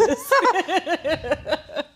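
loud, high burst of laughter; big rolling laugh; amused laugh. Real, not acted. Laughs at a viewer's comment.

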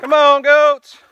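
loud, high herding call; calling livestock. Real, not acted. Farmer summoning goats with an empty bucket, calling loudly. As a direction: holler for the herd to come.

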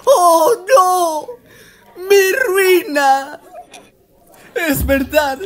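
high, loud mock sobbing cry; hammy wail. Exposed pumpkin king lamenting his ruin, melodramatic wailing. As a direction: overplay the villain's doom, wailing. Villain unmasked, hammy despair.

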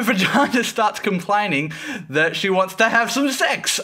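ordinary talk, nothing marked

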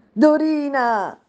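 high, loud, slow stretched yell of the name; furious shout. Acted. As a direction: scream the maid's name in rage. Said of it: "ella gridò".

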